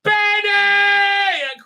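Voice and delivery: high, loud calling of Benny; old woman shrieking for Benny; mock screech. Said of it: "the mad old woman's like".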